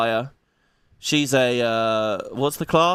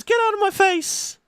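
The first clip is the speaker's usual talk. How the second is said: very high, squeaky angry woman; squeaky mock-female yell; high shrill shout. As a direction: shriek it in a cartoon woman's voice. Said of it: "and she says".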